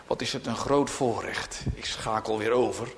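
usual speaking voice

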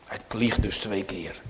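ordinary talk, nothing marked

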